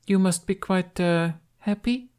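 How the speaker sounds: fond, measured royal question; empress's voice. Acted. Empress addresses the dragon.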